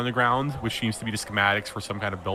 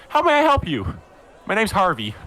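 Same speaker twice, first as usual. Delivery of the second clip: high, bright customer greeting; louder, much higher sales-counter hello; smiling shopkeeper; 10 semitones higher, 4 dB louder than usual. Acted. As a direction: switch into a big smiling welcome. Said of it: "smiles and goes".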